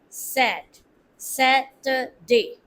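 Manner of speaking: very slow Saturday, each part drawn out; slow model. Shown as a demonstration. Teacher stretches out Saturday slowly for learners to copy.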